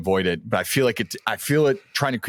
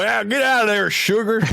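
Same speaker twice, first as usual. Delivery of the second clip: put-on repeating the line in an even bigger Southern accent; thick Southern accent; 12 semitones higher than usual. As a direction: lay the Southern accent on thick and shoo the sugar. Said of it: "a deep Southern accent".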